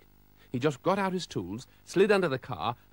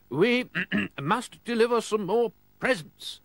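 said quickly; 10 semitones higher, 5 dB louder than usual. Acted. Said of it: "Father Christmas said quickly".

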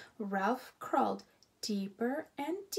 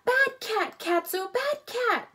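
loud, high scolding shout; 11 semitones higher, 8 dB louder than usual. A narrator's reading. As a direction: yell it at the naughty cat. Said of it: "he heard her shout".

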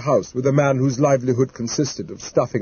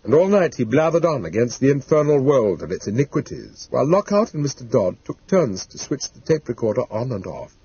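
ordinary delivery, nothing marked

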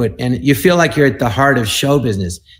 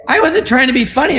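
mock protest; 11 semitones higher than usual. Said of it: "I go".

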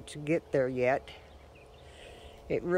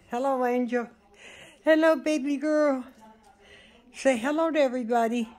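pet talk; 6 semitones higher, faster than usual. Real, not acted. Owner greeting her little dog Angel, high cooing baby talk.

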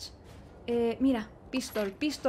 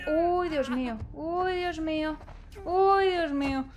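scared exclamations; 4 semitones higher, 4 dB louder than usual. Real, not acted. Frightened by the infected man.

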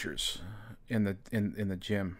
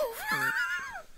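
high whooping cackle; whooping laugh; 3 dB quieter than usual. Retold or imitated. Narrator whoops after flipping off his ex, high cackle. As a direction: let out a wild triumphant whoop. Triumphant yell after insult.

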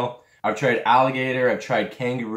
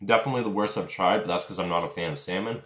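chewing while talking; 2 semitones lower, 3 dB quieter, faster than usual. Real, not acted. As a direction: mouth partly full. Seen 1: Bit jerky before; talks with hand covering chewing mouth.

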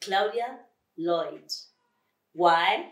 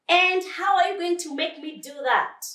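high, loud taunt; defiant crocodile; 11 semitones higher, 7 dB louder than usual. Acted. Crocodile asked to play fair, defiant. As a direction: challenge him loudly, pitched high. Crocodile defies Lion's request.

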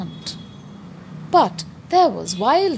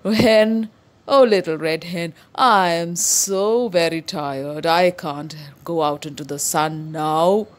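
long drawn-out mooing cow voice, slow and weary; lazy tired cow; 3 semitones lower, slower than usual. Acted. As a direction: drag the words out like a tired cow. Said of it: "And the cow said".

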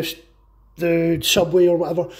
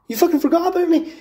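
much higher, louder, fast furious snarl; furious vampire; 11 semitones higher, 4 dB louder, faster than usual. Acted. Mutated vampire raging at Blade for forgetting him, high fast furious. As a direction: spit it out in a sudden burst of rage.

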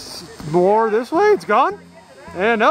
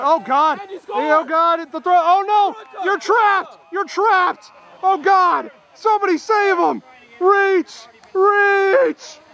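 high-pitched, urgent alarm at the play; frantic play call; 7 semitones higher, slower than usual. Real, not acted. Commentator urging a trapped runner to reach, frantic. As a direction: cry out as the runner gets trapped.

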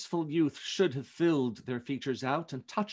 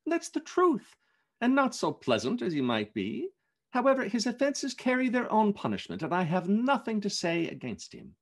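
a hint of a cheerful young man, pitched up; amused but fair about his uncle; lightly voiced jovial nephew; 7 semitones higher than usual. Fred defending Scrooge to his wife, lightly jovial.

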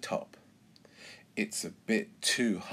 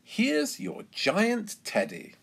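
high, slow, drawn-out announcement; pitched-up stallholder's delight; excited prize presentation; 11 semitones higher, slower than usual. A narrator's reading. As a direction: hand over the big prize with a flourish.

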